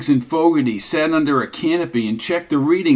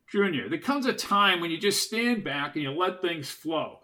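lightly voiced, softer partner; Dutch, patient partner; 2 semitones higher, 7 dB quieter than usual. Dutch advising Junior to let things flow, soft and patient. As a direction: counsel him quietly.

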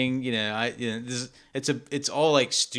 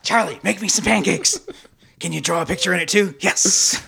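bossy mock voice; 7 semitones higher, 6 dB louder than usual. Mock order to Charlie.

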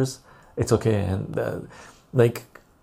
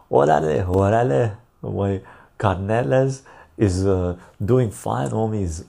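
put-on [name] Chicano accent. Streamer greeting chat in a put-on [name] Chicano accent. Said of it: "I'm trying to do the S P M accent".

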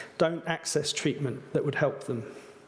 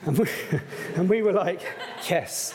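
laughing; 3 semitones higher than usual. Real, not acted. Laughing at brother's story.

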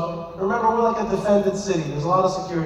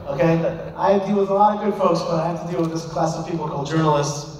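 ordinary talk

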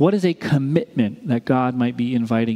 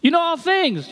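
hurt; 14 semitones higher than usual. Retold or imitated. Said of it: "Peter was hurt".

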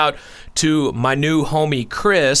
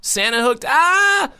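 excited; 11 semitones higher than usual. Real, not acted. Excited gift talk.

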